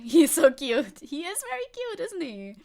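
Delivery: high, loud doting coo; gushing over dog. Real, not acted. Streamer shows chat her dog's photo, doting coo.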